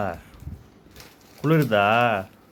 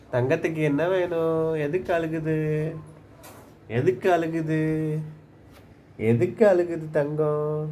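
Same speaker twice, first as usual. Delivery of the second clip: singsong pet-talk with stretched endings; 3 semitones higher, much slower than usual. Real, not acted. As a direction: coo slowly to soothe a crying little darling. Cooing to a crying little one.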